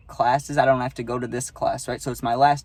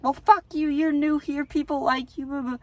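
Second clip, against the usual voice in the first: much higher, shrill-pitched mock yelling; retold yelling girl; 12 semitones higher than usual. Classmate flipping out at him in class, mock furious yelling. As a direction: imitate her yelling at you, pitched way up. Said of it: "this girl's flipping out on me".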